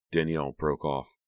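plain reading voice

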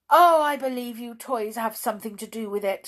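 loud, fast suspicious accusation; angry shout. Acted. Angry doll accusing the toys of mischief, shouting. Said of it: "shouted the angry doll".

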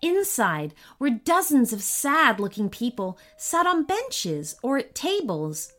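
ordinary delivery

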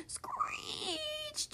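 high, squealing brakes effect; screeched said like skidding to a stop; drawn-out screech. A narrator's reading. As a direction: stretch the word into a squeal.

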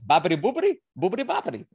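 high-pitched cartoon mock-Italian babble; Peter Griffin. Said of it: "Peter Griffin is just like".

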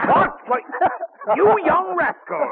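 put-on sudden high, loud outburst at the boy; angry outburst. James explodes at his younger brother Benjamin, shouting in outrage. James discovering Ben's trick.

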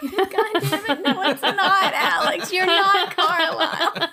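laughing while protesting. Real, not acted. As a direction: giggle through the words. Laughing at the joke.

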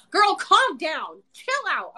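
high, drawn-out mock telling-off. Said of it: "my higher self was like".

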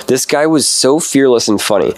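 usual speaking voice

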